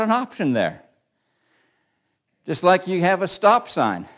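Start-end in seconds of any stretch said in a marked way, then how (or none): none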